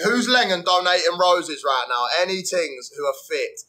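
ordinary delivery

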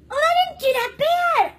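high, loud, drawn-out surprise; slow, high delighted outburst; pleased surprise. Real, not acted. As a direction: exclaim it in happy relief. Sees own makeup in mirror.

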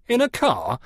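high-pitched, disbelieving question; incredulous. A narrator's reading. Rummins hearing the commode will go by car, incredulous. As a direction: query it in open disbelief. Seller astonished at the plan.

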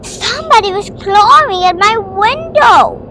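high, loud, panicky voice; frightened child. Acted. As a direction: blurt it out in fear. Frightened child repeats alarm.

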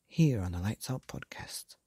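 usual speaking voice

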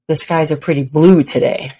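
"blue" emphasized, falling away afterwards; stress on blue. Shown as a demonstration. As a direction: lean on the word "blue". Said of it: "with emphasis on a different word each time".

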